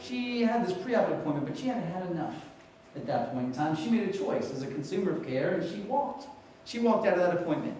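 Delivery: ordinary talk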